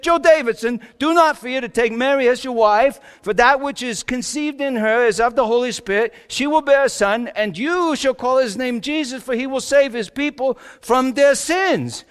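high, loud heavenly announcement; proclaiming angel. Acted. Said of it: "This is what the angel said".

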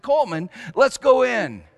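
high, loud eager urging; eager invitation. Retold or imitated. Father urging his son into the sewer, eager call. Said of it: "so I said".

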